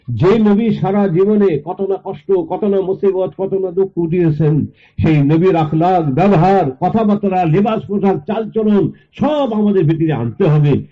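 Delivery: high, sustained exhortation; impassioned preaching. Real, not acted. Preaches at full force.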